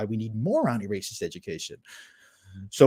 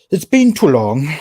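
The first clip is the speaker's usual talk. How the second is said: emphatic louder repeat; 4 semitones higher, 12 dB louder than usual. Real, not acted. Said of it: "It's been too long now".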